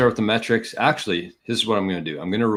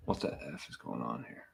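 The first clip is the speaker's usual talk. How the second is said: much lower and softer, under the breath; muttered curse; 7 semitones lower, 13 dB quieter than usual. Real, not acted. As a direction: grumble at the screen to yourself. Muttered at a glitch.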